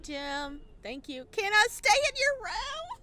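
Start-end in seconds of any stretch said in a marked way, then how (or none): 1.3-3.0 high, loud teasing question; cheeky squeal. Real, not acted. Streamer picking the bold option, high and giddy.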